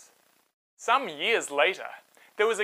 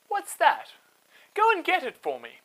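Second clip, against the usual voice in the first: much higher, bright surprised voice; curious princess; 11 semitones higher, 5 dB louder than usual. Acted. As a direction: point and call to her servants. Princess spots the basket.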